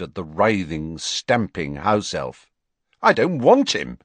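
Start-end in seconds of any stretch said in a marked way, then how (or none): 3.0-4.0 louder, flat refusal; firm refusal. A narrator's reading. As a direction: refuse it with disgust. Harry refusing firmly.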